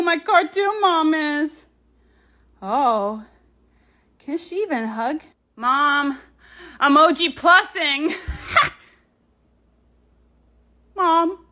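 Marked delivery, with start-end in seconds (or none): none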